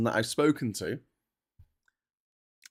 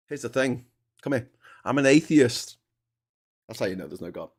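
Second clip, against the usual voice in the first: usual speaking voice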